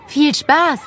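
hoch und lauter nachgerufen; hinterhergerufen. A narrator's reading. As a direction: ruf es den Kindern hinterher. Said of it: "rief ihnen Frau Winter hinterher". (Frau Winter ruft den Kindern nach: hoch und laut)

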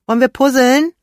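high, loud call with a rise on wir; mother calling her daughter from the kitchen. A narrator's reading. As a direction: call out from another room. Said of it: "rief Mama aus der Küche".

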